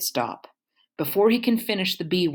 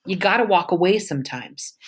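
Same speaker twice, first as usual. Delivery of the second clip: ordinary delivery, nothing marked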